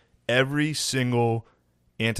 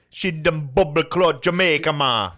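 put-on loud, hammy Jamaican accent; 5 semitones higher, 5 dB louder than usual. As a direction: bellow it in mock Jamaican patois. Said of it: "do Jamaican accent".

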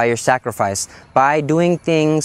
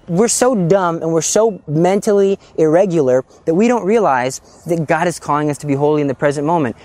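ordinary talk

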